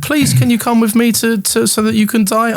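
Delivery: high, pleading put-on woman's voice; stupid woman voice. Mocking a pleading woman to his friend. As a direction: squeak it like a begging woman. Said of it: "whatever stupid voice you're doing".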